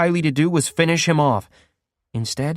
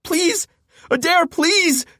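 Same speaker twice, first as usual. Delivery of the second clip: high, cracking plea for mercy; desperate begging; slower than usual. Acted. Captive begging his torturer, high desperate plea. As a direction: beg him to stop with everything left. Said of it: "I begged".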